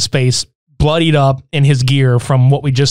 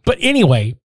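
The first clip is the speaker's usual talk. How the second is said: ordinary delivery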